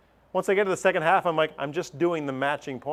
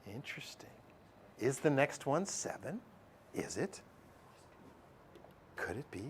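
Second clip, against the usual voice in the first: hushed suspense; 16 dB quieter than usual. Acted. Teacher builds suspense over the pattern, hushed. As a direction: drop to a near hush, wondering. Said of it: "teacher builds suspense".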